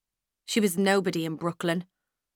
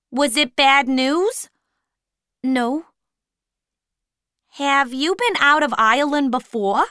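higher, louder probing questions; voiced supervisor; 6 semitones higher, 7 dB louder than usual. A narrator's reading. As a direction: dig for the cause. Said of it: "Miss Fortini".

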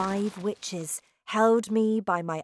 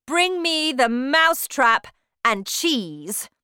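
loud, higher witch's order; commanding shout; 5 semitones higher, 6 dB louder than usual. A narrator's reading. Grand High Witch hunts the mouse, loud shout. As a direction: call out an imperious order. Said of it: "shouted the Grand High Witch".

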